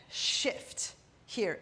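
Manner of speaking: breathy cue. Shown as a demonstration. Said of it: "counting moves aloud".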